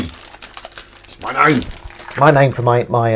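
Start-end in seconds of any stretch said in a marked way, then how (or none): 0.0-1.6 drawn out. Real, not acted. Repeating a word, drawn out.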